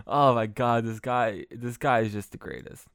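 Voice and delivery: ordinary talk, nothing marked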